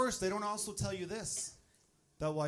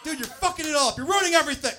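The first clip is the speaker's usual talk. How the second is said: very high, loud berating; exasperated coaching; 11 semitones higher, 11 dB louder than usual. Acted. Dad scolding baby being born, exasperated shouting. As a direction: blow up at them at full pitch. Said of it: "coaching him on the way out".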